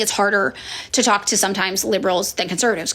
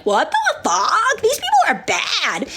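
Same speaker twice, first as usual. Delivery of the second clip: much higher, slow, laughing disbelief; shocked realization; 12 semitones higher, slower than usual. Retold or imitated. Liberal realizing the truth, slow high laughing disbelief. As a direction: drag it out as it dawns on you.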